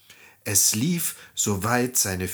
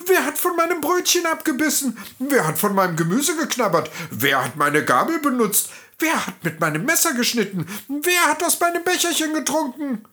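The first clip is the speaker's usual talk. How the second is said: very high, fast, squeaky-tiny dwarf voices; a flurry of worried little dwarfs; excited dwarf babble; 13 semitones higher, faster than usual. A narrator's reading. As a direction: tumble the questions out in agitation. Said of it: "ein aufgeregtes Stimmengewirr".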